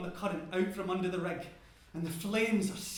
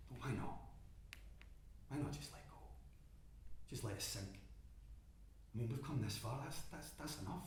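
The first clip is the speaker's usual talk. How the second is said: low, much softer whisper of giving up; hushed, tempting voice in your own head; inner whisper; 7 semitones lower, 14 dB quieter than usual. Acted. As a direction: breathe it to yourself like a temptation.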